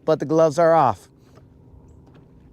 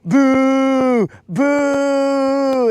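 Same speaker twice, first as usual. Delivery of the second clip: loud, high mock boos from a crowd; crowd booing; 5 semitones higher, 4 dB louder than usual. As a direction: boo him like an angry studio audience. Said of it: "They'll be all like".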